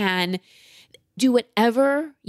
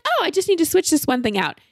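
high, breezy quote of the electrician; electrician's casual remark; 9 semitones higher than usual. Retold or imitated. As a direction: toss it off lightly like a handyman.

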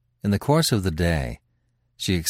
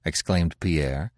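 ordinary delivery

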